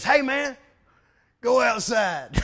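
loud mock call, stretching the last words. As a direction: imitate a buddy hollering down the phone. Acts friend's excited phone call.

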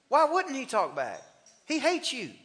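ordinary talk, nothing marked

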